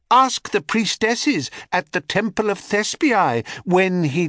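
high, quick bird voice pleading bravely; a tiny bird standing up to a queen; brave little bird. A narrator's reading. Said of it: "bravely calling out".

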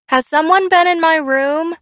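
high, loud call to another floor; calling downstairs. A narrator's reading. Daughter shouting down to her parents from upstairs, calling out. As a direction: call it out loudly, pitched right up. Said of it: "she called downstairs".

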